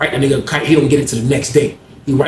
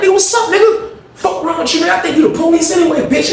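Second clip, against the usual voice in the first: high-pitched angry shout; heated yell. Retold or imitated. Prison yard quarrel retold.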